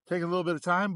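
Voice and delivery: puffing between words; out of breath. Real, not acted. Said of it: "out of breath there".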